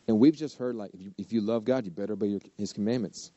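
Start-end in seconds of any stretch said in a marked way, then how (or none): none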